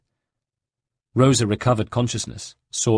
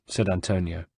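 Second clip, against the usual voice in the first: ordinary delivery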